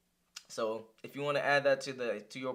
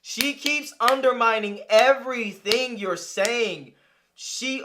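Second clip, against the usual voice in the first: heated rant; 9 semitones higher, 9 dB louder, slower than usual. Real, not acted. Still fuming at the guest.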